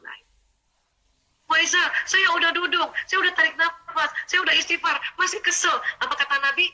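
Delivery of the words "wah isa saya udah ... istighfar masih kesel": higher whiny mock complaint; complaining listener. Imitating a woman complaining to Isa, higher and whiny. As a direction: whine that nothing has worked yet.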